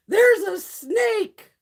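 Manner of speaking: high, loud and drawn-out slow warning; hammed-up panic as the girls shout about the snake; frightened coworker yelling that there's a snake; panicked cry.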